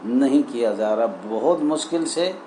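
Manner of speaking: ordinary delivery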